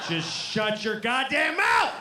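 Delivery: shouting high and slow, hammed-up rage; mock furious yell. Dad yelling at his chatty kid at night, hammed-up shout. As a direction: blow up at the kid in mock fury.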